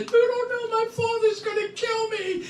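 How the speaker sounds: very high squealed mock wail; mock panic. Drunk son fearing his father, squealed mock panic. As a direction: squeal it out like a frightened kid.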